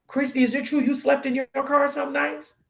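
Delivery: high, incredulous question; blown-away questioning. Retold or imitated. Acquaintance asking about sleeping in the car, astonished. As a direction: imitate a stunned friend asking carefully. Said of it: "they were blown away".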